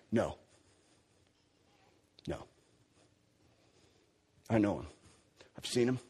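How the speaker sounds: hushed, steady and resolute; a quiet, immovable conviction; quiet defiance. Retold or imitated. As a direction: say it low and firm, no backing down. Peter defying the authorities, voiced.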